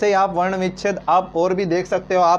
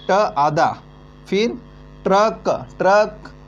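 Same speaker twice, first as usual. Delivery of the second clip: Truck repeated slowly and carefully; slow repeating; slower than usual. Shown as a demonstration. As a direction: spell out truck slowly for students. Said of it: "truck क्या होता है". (Truck धीरे-धीरे दोहराते हुए)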